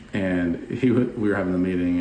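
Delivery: ordinary talk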